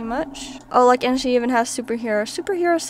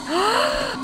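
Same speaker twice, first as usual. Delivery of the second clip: high-pitched gasp; startled gasp; 11 semitones higher, 3 dB quieter than usual. Real, not acted. Player reads of an energy failure, alarmed gasp.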